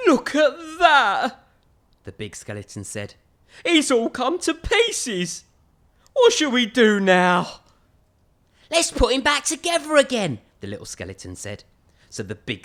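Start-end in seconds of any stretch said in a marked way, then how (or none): 0.1-1.5 higher, slow, a touch of crying dismay; dismayed big skeleton. Acted. Big skeleton sees the dog in pieces, mock dismay. 3.7-7.6 higher and slow, mildly fretful; worried big skeleton. Acted. Big skeleton wonders how to fix the dog, mild worry. 8.7-10.4 higher, cheerful fix-it voice; little skeleton voice. Acted. Little skeleton suggests rebuilding the dog, higher voice.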